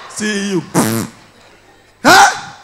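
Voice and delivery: ordinary talk, nothing marked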